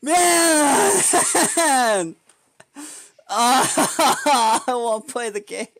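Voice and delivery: loud, high mock-sobbing wail; anguished, stretched-out whine; wailing complaint. Real, not acted. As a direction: drag it out like a tantrum. Meltdown over bad luck.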